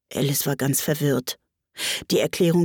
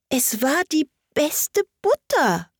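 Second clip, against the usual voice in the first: high, slow, humble hare; sheepish, slow little excuse; meek; 9 semitones higher, slower than usual. A narrator's reading. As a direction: plead timidly in a high character voice. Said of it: "sagte der Faselhase demütig".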